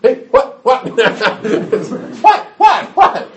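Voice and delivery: high, loud hammed-up squawks of protest; shocked child. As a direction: play outraged disbelief in bursts. Child protests surprise consequence.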